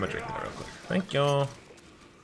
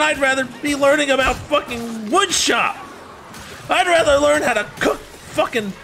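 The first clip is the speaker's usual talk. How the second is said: high-pitched, loud yelling tirade; furious rant; 14 semitones higher, 4 dB louder than usual. Acted. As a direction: let loose an outraged, screamed rant. Acted imagined rant back at teacher.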